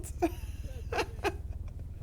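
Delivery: high airy laugh; breathy laugh. Real, not acted. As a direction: wheeze out a laugh.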